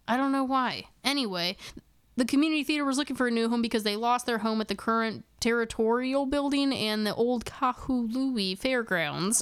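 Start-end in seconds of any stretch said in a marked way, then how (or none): 2.2-9.4 fast, rushed rereading. Real, not acted. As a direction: hurry back through the sentence. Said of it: "I have to, like, prep myself every time".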